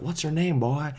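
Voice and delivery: the stranger taunting the boy, a mock bully voice. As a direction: needle him like a bullying stranger. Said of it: "He's like".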